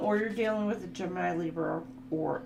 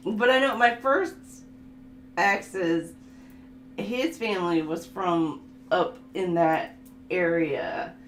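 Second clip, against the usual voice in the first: ordinary talk, nothing marked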